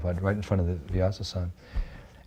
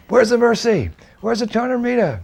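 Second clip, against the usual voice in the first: put-on high, loud eager demand from a crowd of boys; excited shouting; 14 semitones higher, 5 dB louder than usual. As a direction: call it out loud and high, hungry for the treat.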